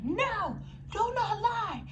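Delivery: firm outburst against liars; emphatic high rant. Real, not acted. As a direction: insist hard, pitch up.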